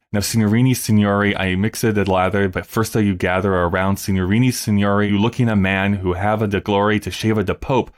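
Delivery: hammed-up Italian showman pitch, lightly voiced; a hint of a heavy Italian accent; mock Italian accent. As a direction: sell it like a boastful Italian barber, accent only lightly done. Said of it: "Pirelli jumps into it and goes".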